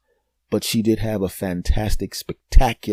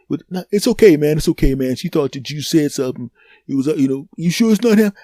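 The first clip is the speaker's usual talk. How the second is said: put-on placating boyfriend; 5 semitones higher, 5 dB louder, faster than usual. Boyfriend reassures foreigner, questions lady.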